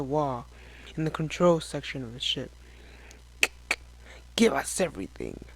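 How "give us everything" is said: hushed, menacing demand; whispered demand. A narrator's reading. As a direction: breathe the order low. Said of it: "one of the creatures demanded".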